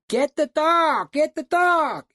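high, loud, slow shouted order; retold yell to get the dog. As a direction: bellow down the cliff at him. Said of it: "I started yelling at him".

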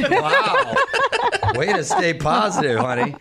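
a high laughing wow, then teasing through laughter. Real, not acted. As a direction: laugh it off at her gloomy joke. Laughing at wife's gloom.

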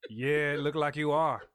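slow, loud Cajun drawl; put-on Cajun voice; Cajun accent. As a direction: answer as a laid-back Cajun swamp man. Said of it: "I'm trying to do a Cajun voice".

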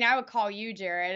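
ordinary delivery

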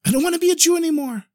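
high-pitched, rushed declaration; defiant outburst. Acted. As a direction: blurt it out high and fast. Acted defiant husband arguing with wife.

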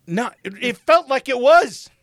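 loud, high-pitched protest; exasperated shout. Real, not acted. Answering a friend's Spirit Airlines jab, exasperated shout. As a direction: blurt it out in comic outrage. Ranting about the awful flight.

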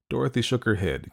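plain reading voice

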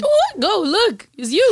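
high pitched effect voice. Real, not acted. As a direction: chipmunk filter on the voice. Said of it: "Ito's putting effects on us".

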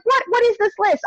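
high-pitched mock bewilderment; incredulous bafflement. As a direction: demand an answer in exaggerated disbelief. Acts out bafflement about the list.

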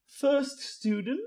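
high, slow summons; high, drawn-out owl announcement; owl keeper. Acted. Owl calls the first student.

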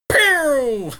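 high sharp pew; laser pew sound. Shown as a demonstration. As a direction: fire off a laser zap.

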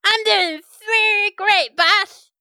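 high, loud eager sidekick; high loud helper voice; Steve's voice. Acted. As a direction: squeak it out like a keen little minion.

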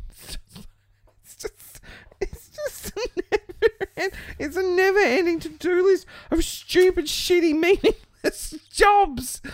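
falling apart between laughs and tears; laughing through tears. Real, not acted. Laugh-crying breakdown.

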